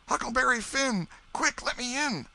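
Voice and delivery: put-on high, urgent boy voice pleading; frantic boy. Huck pleading at the Welshman's door, frantic. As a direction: beg to be let in, fast.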